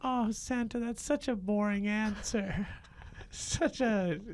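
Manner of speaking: put-on high, curious kid voice; a wide-eyed kid needling Santa; child's voice. Child up late on Christmas Eve questioning Santa, high kid voice.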